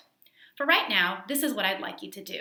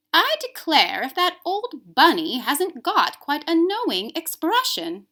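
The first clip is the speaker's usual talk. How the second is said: higher, louder, slow marvelling nanny; surprised Nana; 9 semitones higher, 10 dB louder, slower than usual. A narrator's reading. Nana notices the bunny's knowing look next morning, marvelling. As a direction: marvel at the old bunny like a nursemaid. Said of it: "picked him up and said".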